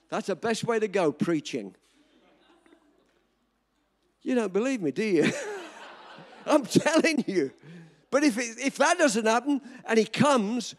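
ordinary talk

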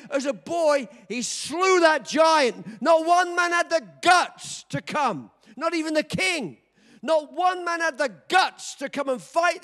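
loud, high preaching climax; preaching at full force. Acted. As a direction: build it like a sermon peak.

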